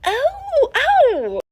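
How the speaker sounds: high squeaky startled oh. Retold or imitated. Lily realising she met James's mom, startled. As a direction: gasp it out in dismay. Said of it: "And Lily's like".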